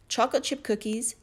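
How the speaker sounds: usual speaking voice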